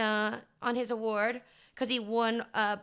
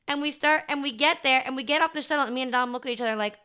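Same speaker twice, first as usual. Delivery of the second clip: ordinary delivery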